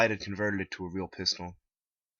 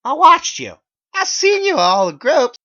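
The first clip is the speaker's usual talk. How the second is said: furious accusing shout; 19 dB louder than usual. A narrator's reading. Narrator confronts Megan at the group, much higher and louder. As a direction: yell it in her face.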